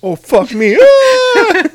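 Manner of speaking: hammed-up high, loud wail; mock bawling. Husband imitating son joining mom in tears, mock bawling. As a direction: imitate a kid bursting into loud crying. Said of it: "And he joined into it".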